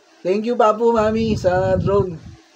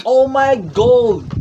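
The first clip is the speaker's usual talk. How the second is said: excited; 5 semitones higher, 7 dB louder, slower than usual. Real, not acted. Reacts to the gift.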